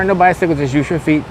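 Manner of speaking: usual speaking voice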